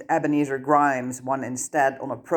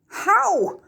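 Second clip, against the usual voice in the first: a much higher, louder shrieked question; near shriek; 4 dB louder than usual. Acted. Hermione nearly shrieks at Harry in panic. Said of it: "Hermione all but shrieked".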